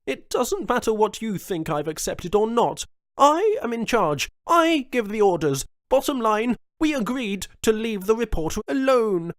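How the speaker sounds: high and loud, asserting authority; commanding governor. A narrator's reading. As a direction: lay down the law.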